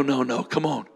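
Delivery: normal talking voice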